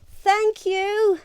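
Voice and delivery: high, loud heartfelt thanks; big thank you. Acted. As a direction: thank her with all the town. Townsfolk chorus thanks.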